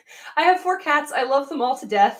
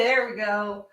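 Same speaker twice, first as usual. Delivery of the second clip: normal talking voice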